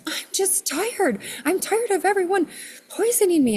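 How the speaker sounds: high-pitched, fed-up complaint; Mother Earth. Retold or imitated. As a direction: whine it high, an exhausted planet venting. Voicing weary Mother Earth's complaint.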